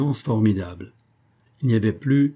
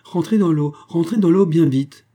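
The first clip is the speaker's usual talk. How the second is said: higher, louder panicked orders; terrified cry; 7 semitones higher, 7 dB louder than usual. A narrator's reading. Baroness frantically ordering the count back into the water. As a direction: hurry him back under the water. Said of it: "cria Adeline d'une voix terrifiée".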